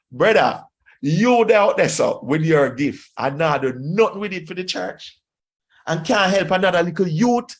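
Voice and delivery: put-on Jamaican accent. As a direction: scold a brother in broad Jamaican Patois. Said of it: "in my Jamaican, uh, accent and language".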